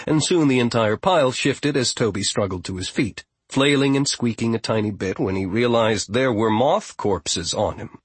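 ordinary delivery, nothing marked

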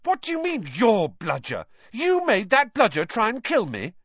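indignant, pitch climbing; rising anger. A narrator's reading. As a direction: demand it with mounting anger. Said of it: "anger rising once more".

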